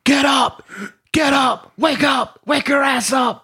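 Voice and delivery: high-pitched drill-sergeant shout; imitated sergeant barking. Sergeant waking recruits on the bus, imitated barking shout. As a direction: bark it like a sergeant rousing recruits. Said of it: "starts bah, bah, bah, barking".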